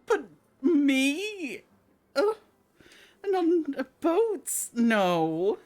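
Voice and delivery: high, whining protest; whiny boy leader. Acted. Boy leader balks at buying boat, high and whiny. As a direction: whine like a spoiled child.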